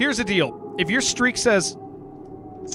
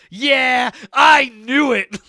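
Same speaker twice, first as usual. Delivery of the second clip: slow, high, drawn-out shout of triumph; hammed-up slow victory shout; mock gloating yell; 5 semitones higher, 4 dB louder, much slower than usual. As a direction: yell it slowly like you called it all along. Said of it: "and I'm like".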